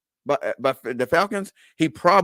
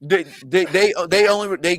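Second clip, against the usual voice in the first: laughing mock; 6 semitones higher, 4 dB louder than usual. Mocking the stats argument, laughing.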